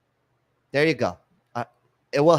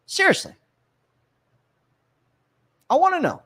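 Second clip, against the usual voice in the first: much higher, indignant demand; mock-indignant challenge to algebra users; exasperated; 3 dB louder than usual. Real, not acted. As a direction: shoot the pitch up in disbelief.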